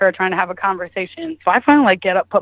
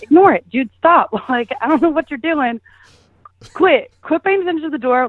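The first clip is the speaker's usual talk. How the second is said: higher-pitched, insistent retold plea; urgent pleading; 6 semitones higher than usual. Woman telling her hookup to ignore the door, urgent. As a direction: re-enact urging someone to drop it.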